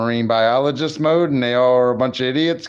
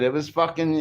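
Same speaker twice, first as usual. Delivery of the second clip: ordinary talk, nothing marked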